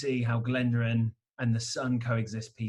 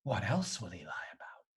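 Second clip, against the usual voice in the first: conspiratorial whisper; 5 semitones lower, 8 dB quieter than usual. Acted. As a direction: whisper it, suspicious and secret. Suspicious aside to Glenda.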